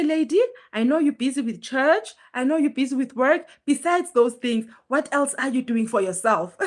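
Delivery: ordinary delivery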